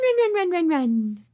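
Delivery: ordinary talk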